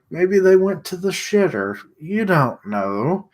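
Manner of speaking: high, teasing put-on voice for Nate; voicing Nate. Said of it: "Nate's like".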